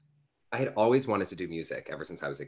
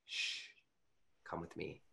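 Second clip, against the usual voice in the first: retold grandma's near-silent shush, much softer; hushed; 15 dB quieter than usual. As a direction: hush him so the family won't hear. Grandma sneaking me away unseen.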